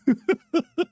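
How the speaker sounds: sudden high squeaky laugh; real high-pitched giggle. Real, not acted. Laughing at timer chat.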